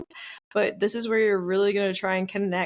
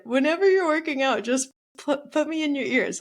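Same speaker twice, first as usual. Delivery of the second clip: playful excited; 7 semitones higher, 3 dB louder than usual. Real, not acted. Plugging own podcast playfully.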